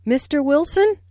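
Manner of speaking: Wilson lifted up at the end, like calling someone; Mister Wilson, rising to get his attention; rising tune on address. Shown as a demonstration. Teacher demonstrating a rising call to Mister Wilson. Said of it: "Notice the rising intonation on Mister Wilson".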